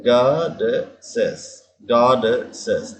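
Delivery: गॉडेसेस said very slowly, each syllable drawn out; slow pronunciation demo. Shown as a demonstration. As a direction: spell out गॉडेसेस slowly for the class. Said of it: "इसमें डी double डी का sound आता है". (गॉडेसेस को धीरे धीरे बोलकर दिखाना)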